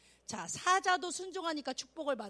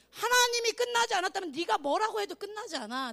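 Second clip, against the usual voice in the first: higher, raised-voice declaration; forceful retort; 6 semitones higher, 5 dB louder than usual. Acted. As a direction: push back firmly with preaching force. (높고 힘 있게 단호히 선언)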